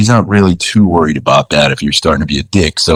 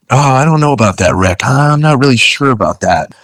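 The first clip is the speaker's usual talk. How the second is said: higher-pitched, politely held-back doubt; biting his tongue; 6 semitones higher than usual. Retold or imitated. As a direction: mimic someone holding back to stay polite. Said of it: "really biting his tongue".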